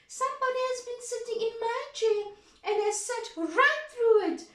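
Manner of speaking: very high, fast little cub; high, quick baby bear cry; tiny wee bear voice. Acted. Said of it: "cried little Wee Bear in his little wee voice".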